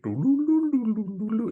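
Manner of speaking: vocal harp sweep going back in time; high trilled bulululu like a dream-sequence harp; harp glissando. Shown as a demonstration. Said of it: "that harp music?".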